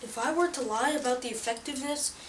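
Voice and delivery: loud, pushy infomercial pitch; hard sell ad. Acted.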